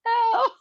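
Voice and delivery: sharp high cry of pain; real yelp from a cramp. Real, not acted. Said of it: "I'm starting to cramp".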